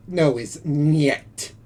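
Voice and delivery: niet drawn out slowly; pronouncing niet. Shown as a demonstration. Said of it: "The Russian word for no".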